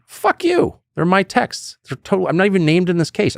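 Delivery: high, defiant retort, lightly hammed-up; mock-snap back at the lawyers; imagined defiance. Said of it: "that's what I should have said".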